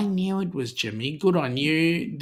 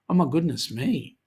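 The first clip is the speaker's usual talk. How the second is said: normal talking voice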